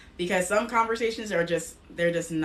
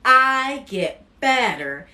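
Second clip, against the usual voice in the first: higher, louder, slow, proclaiming each line; emphatic affirmations; 5 semitones higher, 6 dB louder, slower than usual. Acted. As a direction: declare it for the audience to repeat. Affirmations to repeat after her.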